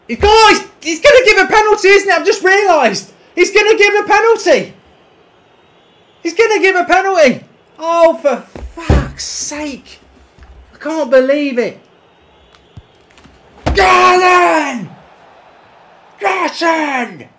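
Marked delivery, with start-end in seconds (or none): 0.1-2.1 alarmed. Real, not acted. Sudden realisation about penalty. 3.3-7.4 agitated. Real, not acted. Repeats penalty worry loudly. 13.7-17.3 loud cry of a name; yelling at the screen; shouted name. Real, not acted. Calling a player in game.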